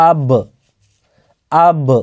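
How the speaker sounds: আব with the final ব released in a short bouncing echo; qalqalah bounce. Shown as a demonstration. Teacher tells students to listen well, then bounces ব. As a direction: pop the closing ব of আব, tajweed style. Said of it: "আমি বলছি ভালোভাবে আপনারা শুনবেন".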